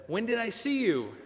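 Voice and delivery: high, startled question; man amazed at being told he saw Jesus; astonished question. Acted. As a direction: rise up in disbelief. Artaban astonished at Jesus' words.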